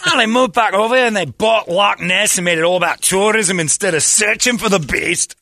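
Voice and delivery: high, loud Scottish rant; hammed-up indignant Scot; mock Scottish complaint. Imitating a Scot griping about tourism, mock-indignant Scottish accent.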